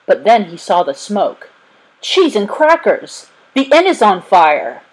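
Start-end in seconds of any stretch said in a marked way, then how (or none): 2.0-4.8 jolted by the sight of flames; alarmed settler spotting the inn burning, crying out; startled cry. A narrator's reading. Bates spots the burning inn.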